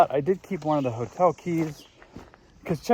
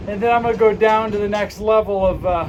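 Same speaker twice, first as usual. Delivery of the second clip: loud and higher, raised over wind; shouting over wind; 4 semitones higher than usual. Real, not acted. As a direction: call out over a roaring wind. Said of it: "I'm gonna talk loud 'cause it's windy here".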